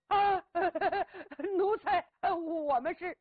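stammering, frightened reply; a servant stammers out of fear. Acted. Servants answer the strategist in a frightened stammer.

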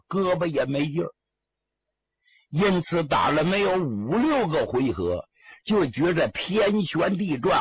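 usual speaking voice

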